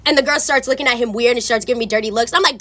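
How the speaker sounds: ordinary talk, nothing marked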